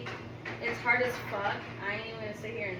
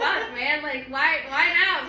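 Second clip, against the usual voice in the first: laughing mock exasperation; 4 semitones higher, 10 dB louder, slower than usual. Retelling ex's late regret.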